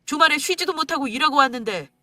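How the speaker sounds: husband angrily listing his sacrifices; angry complaint. Acted. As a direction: throw his sacrifice in her face.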